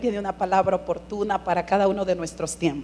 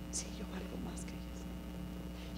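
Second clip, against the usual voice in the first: susurrado, lento y sin voz; susurro; 4 semitones lower, 23 dB quieter, slower than usual. Retold or imitated. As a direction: susurrado como una oración íntima. Responde al Señor en oración. (susurro de oración, lento)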